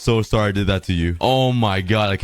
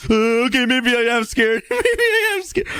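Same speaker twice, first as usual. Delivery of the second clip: scared; 13 semitones higher than usual. Real, not acted. Scared by monster party noises.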